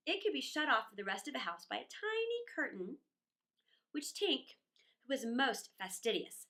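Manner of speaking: usual speaking voice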